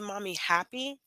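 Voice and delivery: softly imitated little child asking mother; lightly voiced child. Mother voicing her small child.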